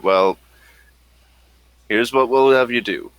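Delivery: usual speaking voice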